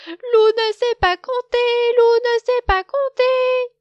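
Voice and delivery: very high, loud, slow chanted taunt; shouted taunt. A narrator's reading. Cochon shouting mockery at Loup, loud and high. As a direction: yell it out, laughing at him. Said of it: "part d'un grand rire et il crie".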